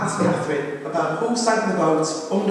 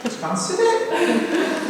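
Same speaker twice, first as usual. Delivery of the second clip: laughing imitation; 11 semitones higher, much slower than usual. Bob's reply in fez.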